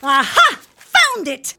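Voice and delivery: loud gleeful aha; triumphant find. Acted. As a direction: crow it out like you've won. Triumphant find during break-in.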